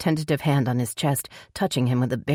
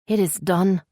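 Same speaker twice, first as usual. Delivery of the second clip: put-on higher, tearful young woman; heroine voice; 4 semitones higher than usual. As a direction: play her close to tears.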